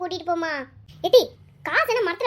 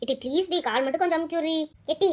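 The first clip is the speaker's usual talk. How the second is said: normal talking voice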